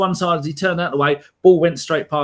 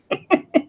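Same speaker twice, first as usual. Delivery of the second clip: high cackle; 12 semitones higher than usual. Real, not acted. Laughing at own cat story.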